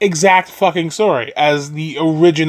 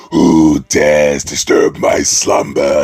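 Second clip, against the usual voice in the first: very low, slow, rumbling giant head; booming cave monster voice; much slower than usual. Retold or imitated. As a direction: boom it out like a colossal stone beast. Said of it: "This big lion head monstrosity ... is like".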